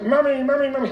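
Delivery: high toddler whine, imitated; quick, high mock-baby cries; one-year-old. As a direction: call for mother like a clingy toddler. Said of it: "He's one, so he's super attached".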